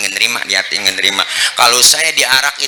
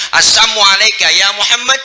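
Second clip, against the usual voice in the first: higher, louder mock greeting; sneering curse; 9 semitones higher, 4 dB louder than usual. Shown as a demonstration. Unbeliever curses the Prophet, higher and louder. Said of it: "Kalau kita dikata begitu".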